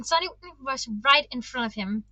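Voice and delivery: ordinary delivery, nothing marked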